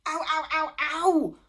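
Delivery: high loud yelps of pain; yelling in pain. A narrator's reading. Anorak yelling as cats bite him, loud pained yelps. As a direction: yelp like you've been bitten. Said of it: "yelled Anorak".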